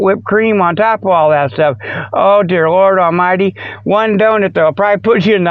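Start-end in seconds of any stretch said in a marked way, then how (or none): none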